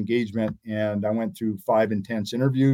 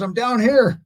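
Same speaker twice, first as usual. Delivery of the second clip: high-pitched holler, only a little louder; imitated calling out; 9 semitones higher, 3 dB louder than usual. Friend calling up from the first floor, imitated yell. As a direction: mimic a buddy calling up to you. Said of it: "I could hear him yelling".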